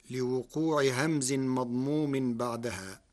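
normal talking voice

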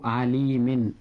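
عليم ending in "-in"; tanween ending. Shown as a demonstration. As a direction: pronounce عليم as "alimin", sounding the final n. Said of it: "عند النطق فنضيف هذه النون". (نطق عليم بتنوين الكسر)

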